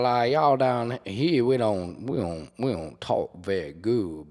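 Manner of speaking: slow, put-on New Orleans drawl; New Orleans accent. Shown as a demonstration. Showing New Orleans South, slow hammed-up accent. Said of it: "New Orleans South".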